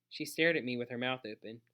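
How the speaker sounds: usual speaking voice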